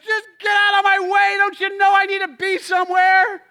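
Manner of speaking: a shouting, impatient driver venting; angry road rage. Retold or imitated. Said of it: "I get angry, and I'm like".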